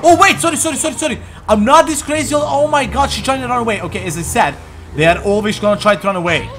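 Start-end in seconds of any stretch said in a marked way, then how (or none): 0.0-3.8 high, quick apologies; startled. Real, not acted. Gamer startled by a fleeing girl, high and flustered. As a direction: jump up in pitch, flustered.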